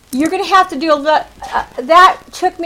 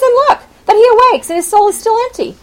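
higher, quicker, ringing reread; dramatic prophet voice; 8 semitones higher, 3 dB louder, faster than usual. Shown as a demonstration. Pastor rereads the verse, now dramatic and high. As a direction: let Isaiah's line ring out. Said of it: "He says".

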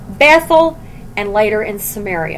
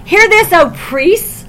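louder and higher, slowing on the priests; God's commanding call; 9 semitones higher, 5 dB louder than usual. Acted. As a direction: demand the priests listen. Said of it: "God's saying".

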